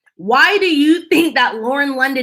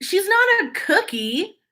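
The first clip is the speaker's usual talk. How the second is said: incredulous; 8 semitones higher than usual. Real, not acted. Indignant point, high pitch.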